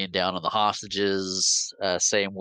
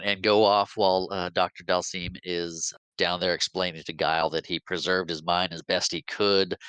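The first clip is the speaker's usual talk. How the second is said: normal talking voice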